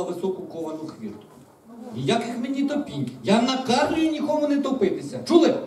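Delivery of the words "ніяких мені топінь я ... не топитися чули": high, loud royal order; imperious, raised-voice scolding; stern command. A narrator's reading. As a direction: lay down the law to a crowd. Princess sternly orders the dragons.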